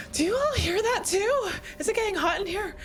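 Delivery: normal talking voice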